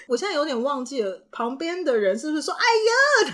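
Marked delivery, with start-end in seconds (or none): 2.6-3.3 much higher, louder cry of dismay; imitating a classmate's loud dismayed yelp; mock dismay. Classmate reacting to accident.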